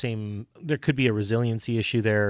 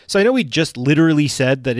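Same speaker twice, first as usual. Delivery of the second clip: normal talking voice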